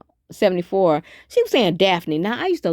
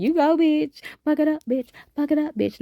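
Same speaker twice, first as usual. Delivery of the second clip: high, playful hype call, sweet not mean; nice, cheering bitch; 9 semitones higher than usual. Shown as a demonstration. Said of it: "that's how you say bitch in a nice way".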